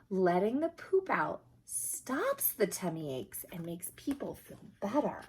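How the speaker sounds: ordinary delivery, nothing marked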